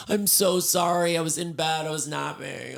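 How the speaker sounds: drawn-out fake just-woke-up apology, starting high and settling; acted apology. Excusing herself at dinner as if fresh from a nap, mock-apologetic. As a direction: play up having just rolled out of bed. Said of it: "going out to dinner and being like".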